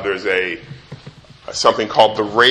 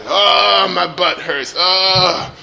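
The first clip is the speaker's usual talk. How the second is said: higher mock whine, drawn-out oh's; lightly hammed-up whining child; whining kid; 7 semitones higher, much slower than usual.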